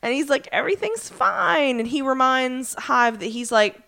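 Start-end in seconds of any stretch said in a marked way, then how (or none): 0.5-1.8 high, mock-casual reassurance; feigned composure. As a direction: pretend all is well in a pitched-up voice. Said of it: "trying really hard not to be like".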